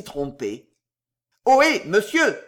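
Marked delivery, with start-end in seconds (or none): 1.5-2.4 louder, higher annoyed hail; irritated shout. A narrator's reading. Sam hailing the stranger in the yard, irritated. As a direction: call out sharply in irritation. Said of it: "s'écria Sam d'une voix irritée".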